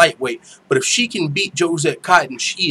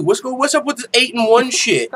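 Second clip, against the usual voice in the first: usual speaking voice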